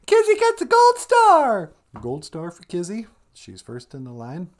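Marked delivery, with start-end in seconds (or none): 0.1-1.7 very high, loud comic voice; high-pitched cheer; squeaky sidekick. Pretend. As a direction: squeal it out like a little puppet. High sidekick announces gold star.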